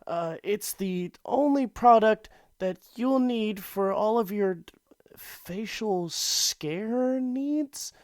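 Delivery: high, shaky-voiced pitchman falling apart; nervous intern ad read. Intern begging listeners to buy acne cream, high and panicky. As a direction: plead through a botched commercial. Acted Richard Scary ad skit.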